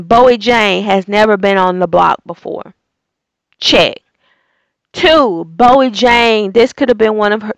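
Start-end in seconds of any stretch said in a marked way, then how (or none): none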